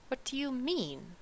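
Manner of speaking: high, soft, caught-off-guard question; a sudden alarmed ask; startled. A narrator's reading. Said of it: "asked Homily, startled".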